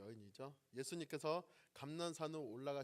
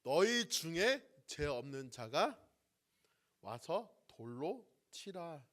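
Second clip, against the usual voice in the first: slow, louder and stern, each word set down; slow, resolute pronouncement of Jesus to the accusers; firm command; 2 semitones higher, 7 dB louder, slower than usual. Acted. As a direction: deliver it as an unbending verdict. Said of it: "단호하게 말씀하시죠".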